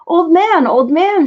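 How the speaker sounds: put-on calling to the old man; shouting. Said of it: "shouted Chang".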